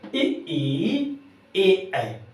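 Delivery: इ, ई, ए, ऐ sounded out one by one; vowel demo. Shown as a demonstration. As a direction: say each vowel clearly and apart. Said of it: "जैसे".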